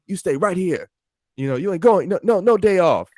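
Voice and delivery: hammed-up boss refusal; mock boss yell. Boss denying the day off, building mock yell.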